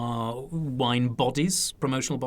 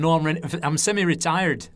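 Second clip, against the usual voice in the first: broad Lancashire storytelling voice; chatty Lancashire impression; Lancashire accent; 3 semitones higher than usual. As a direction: put on an older Lancashire man. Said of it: "So it's my Lancashire accent there".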